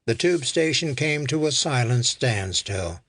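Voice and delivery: ordinary delivery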